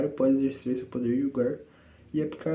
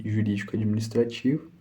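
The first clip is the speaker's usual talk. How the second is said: ordinary talk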